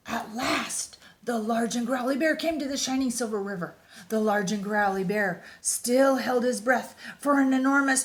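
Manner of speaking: lively picture-book read-aloud; reading to children. A narrator's reading. Reading a bear picture book to young listeners, animated storytime voice. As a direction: read it like storytime for little kids.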